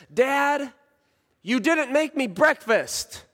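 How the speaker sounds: put-on high, plaintive child's complaint; upset son. Son protesting to dad about skipped breakfast, high-pitched whine. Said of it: "he looks at me and he says".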